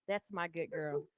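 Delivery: put-on hushed good girl. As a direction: breathe it low and intimate. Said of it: "She gets".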